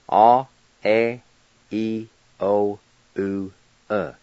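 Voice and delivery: each Cherokee vowel said alone, clear and held; "A, E, I, O, U, E" spoken one by one as a model. Shown as a demonstration. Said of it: "here are the six Cherokee vowel sounds".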